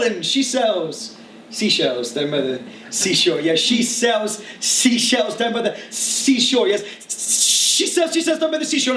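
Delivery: rhythmic spoken-word recital, pitched above chat; driving, tongue-twisting verse; performance poem. Acted. I'm gonna start with a poem called Tongue Tied.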